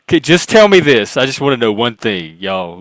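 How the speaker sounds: a hint of bro, starting forceful then settling. Shown as a demonstration. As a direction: launch loudly into a light bro voice. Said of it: "when I talk in my bro voice".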